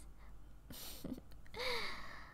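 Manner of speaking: hushed, high little laugh; teasing breath of laughter; soft giggle. Acted. Vampire teases about a kiss.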